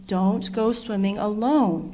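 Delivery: slow, firm lesson; big brother warns sister firmly and slowly; emphatic. A narrator's reading. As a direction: lay down the rule slowly. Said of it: "Trevor said emphatically".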